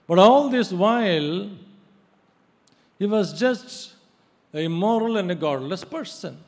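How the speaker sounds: loud, high, impassioned sermon; fiery preaching. Acted. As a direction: drive the point home from the pulpit. Preaching at full force.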